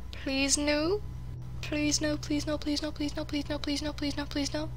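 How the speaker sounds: soft, high, quickening please no chant; frightened pleading. Real, not acted. Gamer alone at night, scared, begging softly. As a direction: beg under your breath, scared.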